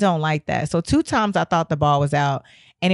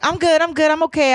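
high, hurried self-imitation; mock reassurance in a raised voice; insisting she's fine; 9 semitones higher than usual. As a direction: pipe up too quickly that you're fine. Acts herself dazed after the hit.

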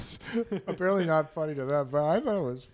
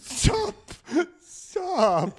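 raised, upset plea to stop; distressed plea; 9 semitones higher, 6 dB louder than usual. Acted. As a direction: beg them to quit it. Co-host pleads amid mock fight.

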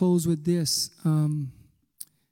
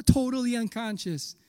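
usual speaking voice

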